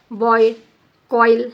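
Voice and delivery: बॉय, कॉइल said singly, stressing the oy glide; emphatic teacher's बॉय, कॉइल; diphthong examples. Shown as a demonstration. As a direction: model the oy sound in बॉय, कॉइल. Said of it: "this is आई sound".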